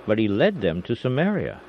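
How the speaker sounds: ordinary delivery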